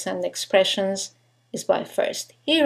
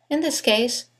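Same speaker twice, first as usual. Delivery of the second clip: ordinary talk, nothing marked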